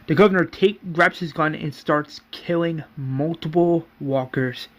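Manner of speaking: ordinary delivery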